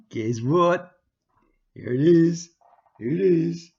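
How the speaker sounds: loud excited reveal, voice pushed up; loud excited announcement; excited shout. Real, not acted. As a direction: call it out with excitement.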